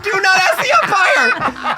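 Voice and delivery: high, loud, slow exasperated yelling. Retold or imitated. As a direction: shout it in disbelief at your kid. Exasperated parent retelling.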